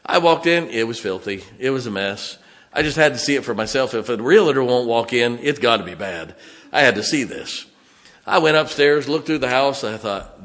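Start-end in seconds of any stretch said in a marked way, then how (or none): none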